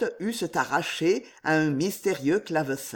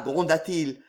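ordinary delivery, nothing marked

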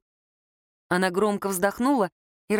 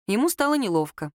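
ordinary delivery, nothing marked

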